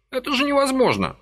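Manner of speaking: high-pitched protest; old man refusing to believe; indignant old man. A narrator's reading. Old man protests to Vasily, high indignant cry.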